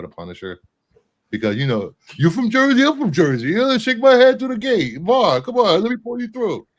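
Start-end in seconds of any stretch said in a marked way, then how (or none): 2.2-6.7 loud, high-pitched attempt at a Jersey accent; botched Jersey accent. Doing a Punisher bit for co-hosts, botched Jersey accent. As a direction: holler it in a hammed-up Jersey accent. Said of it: "That wasn't a Jersey accent".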